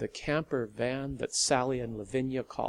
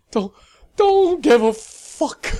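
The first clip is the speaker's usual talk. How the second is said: much higher and louder, gasped through laughs; gasping laughter; 14 dB louder than usual. Acted. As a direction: gasp it out mid-laugh. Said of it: "Lavinia gasped".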